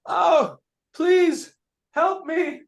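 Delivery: high, loud, slow cry, hit by an arrow; the wounded queen shrieks for help; pained yell for help. Acted. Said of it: "and she yells".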